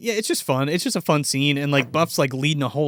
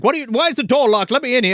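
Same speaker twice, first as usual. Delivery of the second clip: mock indignant butler; 8 semitones higher, 4 dB louder, faster than usual. Butler demands entry, retold.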